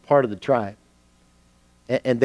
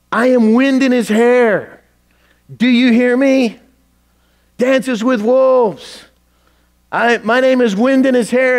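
top-of-lungs yell; 13 semitones higher, 9 dB louder than usual. Acted. Warrior on a cliff calling to his departing friend, yelling. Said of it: "at the top of his lungs, he's yelling".

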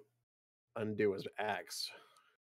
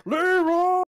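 laughing; 11 dB louder than usual. Real, not acted. Player mocks friend charging ahead.

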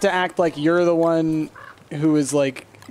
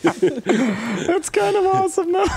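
laughing; 14 semitones higher, slower than usual. Real, not acted. Laughing at the joke.

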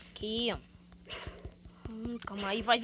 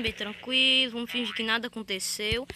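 ordinary talk

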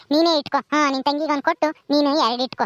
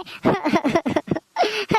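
sobbing; 2 semitones higher than usual. Acted. Maid overwhelmed by the gift, sobbing. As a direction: run of wordless sobs. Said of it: "ಅಳುನೇ ಬತ್ತೈತೆ".

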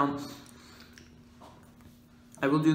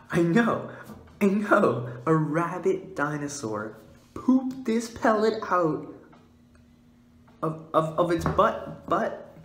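loud, higher excited shouting; hyped reveal; 6 semitones higher, 6 dB louder, slower than usual. Acted. To viewers about the giant pellet, loud excited hype. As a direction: blurt it out, barely holding it together. Revealing rabbit dinosaur poop.

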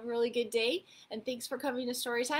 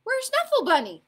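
loud, high, urgent asking; alarmed mommy question; 10 semitones higher, 7 dB louder than usual. A narrator's reading. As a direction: demand to know where the toy is. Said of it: "she asked".

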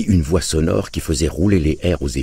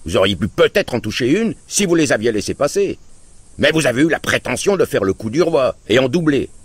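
scolding; 9 semitones higher than usual. Acted. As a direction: rub his nose in the mistake. Uncle scolds father.

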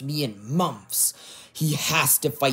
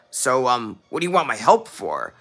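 normal talking voice

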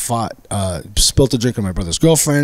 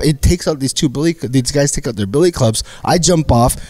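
usual speaking voice

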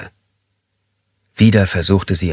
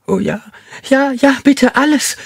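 very high frantic eager pleading; desperate yes; 4 dB louder than usual. A narrator's reading. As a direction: grab at the chance, voice up high. Woman eagerly begs.

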